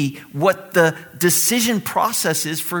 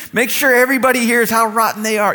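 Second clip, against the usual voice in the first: higher-pitched, eager urging; mock egging on; 6 semitones higher, 4 dB louder than usual. Some of us urging Jesus on, mock-eager. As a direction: egg him on with relish. Acted cheering on the condemnation.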